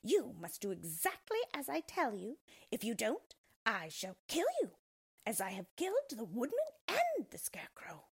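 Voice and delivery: high, menacing witch voice; threatening witch. Acted.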